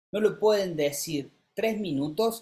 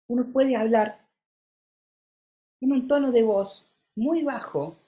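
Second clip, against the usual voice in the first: lifted, higher voice; higher voice demo; 6 semitones higher than usual. Shown as a demonstration. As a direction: show a higher register. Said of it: "casi parezco una mujer cisgénero". (voz más aguda de demostración)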